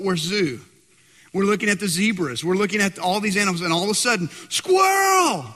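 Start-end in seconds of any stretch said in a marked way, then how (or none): 4.5-5.5 high, loud mock squeal of delight; imitated kid's shout. Son at the zoo spotting a squirrel, mock thrilled shout.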